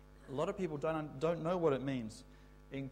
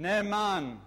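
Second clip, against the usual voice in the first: loud, higher call of the cue word; 6 semitones higher, 10 dB louder than usual. Shown as a demonstration. Teacher calling the cue to the class, loud call. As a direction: call it out so they answer. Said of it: "Let's say that again".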